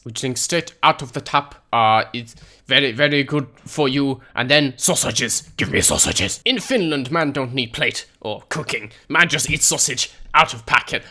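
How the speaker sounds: hammed-up, badly faked Finnish accent. Gamer boasting how Finns drink and eat, bad Finnish accent. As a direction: play a blunt Finn in broken English. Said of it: "that was a bad Finnish accent".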